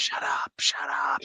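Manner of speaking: hushed, voiceless telling-off; whispered retort. Acted. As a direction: whisper it back at the inner beast. Player whispers back to the beast.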